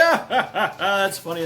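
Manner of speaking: high, gloating ha ha ha; mocking laugh. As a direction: laugh at the loser from the throne. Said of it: "Uthgarda Loki is like".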